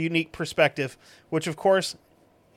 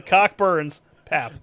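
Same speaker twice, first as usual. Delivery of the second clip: Cockburn's Path drawn out, slow and louder; slow repeat; 4 dB louder, much slower than usual. Real, not acted. Repeating the reviewer's hometown, slow and savouring.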